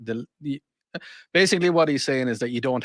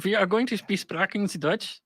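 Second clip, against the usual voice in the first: mock German teacher; 5 semitones higher than usual.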